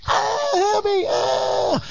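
very high, thin cartoon scream; mock screaming. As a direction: cry for help in a hammed-up panic.

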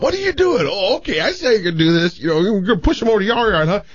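high-pitched voice for the neighbors who caught him; high mock neighbor voice. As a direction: voice the suspicious neighbors up high, half-joking. Neighbors retold catching him.

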